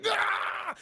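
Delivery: high, shouted cry of pain; pained scream. Retold or imitated. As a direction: yelp as the lightning hits. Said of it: "Saddam's like".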